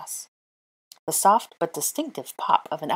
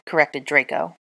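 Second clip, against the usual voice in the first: ordinary delivery, nothing marked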